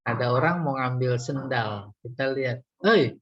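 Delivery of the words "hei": sharp, higher, louder "hey"; short warning shout; scolding shout. Acted. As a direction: shout to scare off a thief. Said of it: "ah, itu rada".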